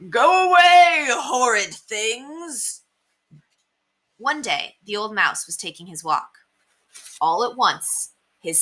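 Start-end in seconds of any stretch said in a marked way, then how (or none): 0.1-2.8 loud high bark, then slow drawn-out scorn; grumpy shout. Acted. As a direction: shout the children away, grumpy old mouse. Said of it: "he would shout".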